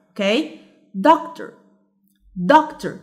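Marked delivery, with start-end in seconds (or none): 1.1-2.9 doctor with DOC high, then dropping; stress on first syllable. Shown as a demonstration. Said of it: "The stressed syllable is".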